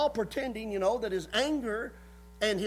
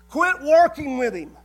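louder, a mock demand from Saul; angry command; 3 semitones higher, 8 dB louder than usual. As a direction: bark it as Saul ordering his son. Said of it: "That's what Saul was saying".

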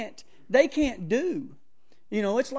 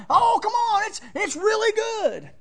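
much higher, louder, slow coaxing; pushy friend voice again; 12 semitones higher, 6 dB louder than usual. Retold or imitated. Friend insisting the pizza is really good, high and coaxing. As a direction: wheedle like an insistent host.

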